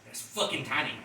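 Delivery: ordinary talk, nothing marked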